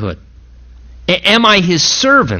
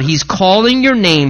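usual speaking voice

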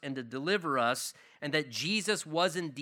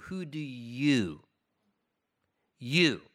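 slow, leaning hard on "you" as if pointing at each listener; "Who do you?" with "you" pressed heavy and low, then "You." alone; stress on you; 4 semitones lower, slower than usual. Shown as a demonstration. Pastor pressing each listener, heavy stress on you. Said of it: "the emphasis clearly maybe was on the word you".

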